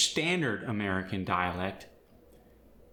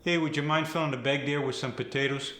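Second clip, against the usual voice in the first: brisk, higher Fargo accent with flat A; 4 semitones higher, faster than usual. Shown as a demonstration. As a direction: ask quickly like a Minnesotan local. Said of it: "Then there's the flat A".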